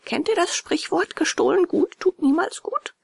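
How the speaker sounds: put-on high, indignant boy's voice; high-pitched character voice, firm; scornful puppet boy. A narrator's reading. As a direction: refuse them in a high boyish voice. Puppet boy scolds the beggars.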